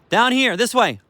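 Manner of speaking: high, loud call down to the dog; dog training call. Shown as a demonstration. Trainer to beagle, high loud calling voice. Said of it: "This is how I train him to my voice".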